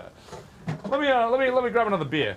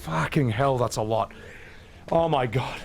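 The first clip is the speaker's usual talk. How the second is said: ordinary talk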